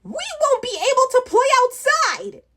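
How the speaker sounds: high goblin wail; whining goblin. Acted. Goblin protests to the others about losing the hat, high wail.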